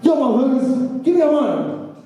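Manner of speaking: high mock stickup demand; the nunchuck robber, played big; hammed-up hold-up line. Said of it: "he would say something like".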